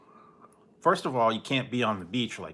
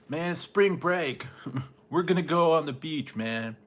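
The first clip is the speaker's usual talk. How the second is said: higher, slow, laid-back party-dude voice with a laugh; mocking spring breakers; 5 semitones higher than usual. Mocking Florida spring breakers ignoring the virus, lazy dude voice. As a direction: imitate a carefree beach partier, drawn out. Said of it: "People in Florida today were like".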